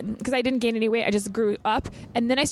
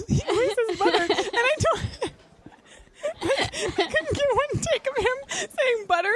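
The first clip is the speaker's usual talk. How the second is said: high voice through giggles; real laughter, higher, slowed; laughing; 13 semitones higher, slower than usual. Real, not acted. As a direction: keep laughing while you explain. Cracking up about Fabio ad.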